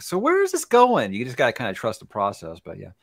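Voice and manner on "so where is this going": high, slow, baffled question; mock bewilderment, drawn out; puzzled reader. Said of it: "there's gonna be times where you can be like".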